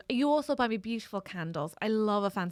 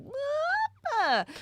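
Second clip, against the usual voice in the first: incredulous. Retold or imitated. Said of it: "And he was like".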